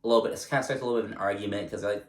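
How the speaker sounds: ordinary delivery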